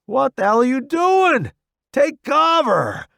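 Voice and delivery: loud, high battlefield yell; urgent shout. A narrator's reading. Stranger yelling at frozen newbie to take cover, urgent shout. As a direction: shout an order under fire. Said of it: "an unfamiliar voice shouts".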